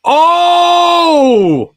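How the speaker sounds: sudden loud high "Oh!"; surprised shout. Real, not acted. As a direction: burst out in shock.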